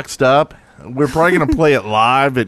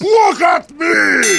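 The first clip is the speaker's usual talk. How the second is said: silly cartoon shout; 13 semitones higher, much slower than usual. Host shouting in a goofy voice for player of the week.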